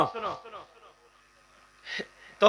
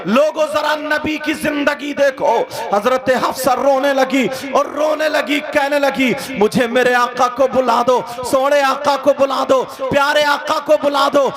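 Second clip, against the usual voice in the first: high, loud, chanting sermon delivery; impassioned preacher singing out the story; full-force preaching; 8 semitones higher, 6 dB louder than usual. Acted. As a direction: preach with soaring, half-sung fervour. Sustained full-force preaching, half-sung.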